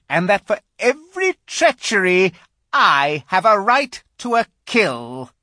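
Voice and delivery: high, shrill witch voice. Acted. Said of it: "her voice growing suddenly shriller".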